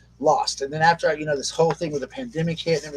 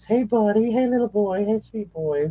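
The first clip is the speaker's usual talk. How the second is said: higher, sweet coaxing voice for a frightened little dog; pet talk; 8 semitones higher, 3 dB louder, slower than usual. Real, not acted. Host soothing his frightened dog mid-stream, higher sweet cooing.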